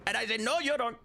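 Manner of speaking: high, hammed-up Indian-accented Trump voice; playful Indian-accent Trump impression. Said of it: "Your Indian Trump is one".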